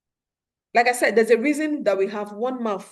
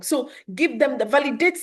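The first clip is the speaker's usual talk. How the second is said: usual speaking voice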